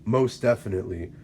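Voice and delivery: usual speaking voice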